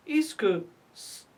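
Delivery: ordinary talk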